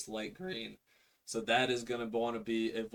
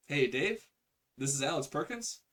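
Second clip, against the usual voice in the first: higher, louder friendly hello with rising ends; enthusiastic, Bucky Buchanan style; 5 semitones higher, 5 dB louder than usual. Shown as a demonstration. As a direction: call like you're greeting an old best friend. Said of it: "the Bucky Buchanan tonality".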